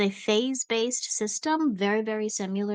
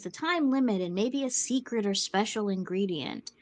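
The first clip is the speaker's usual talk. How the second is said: ordinary talk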